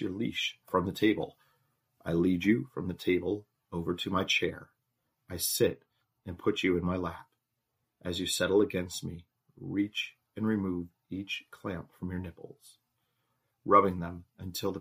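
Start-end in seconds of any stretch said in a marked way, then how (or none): none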